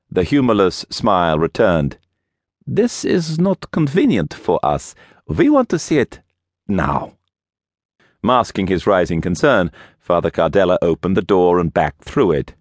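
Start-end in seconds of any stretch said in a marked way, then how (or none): 2.7-7.2 put-on high, insistent Russian accent dropping at the end. A narrator's reading. Russian visitor insists on entering the church, strong Russian accent. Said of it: "he had a strong accent".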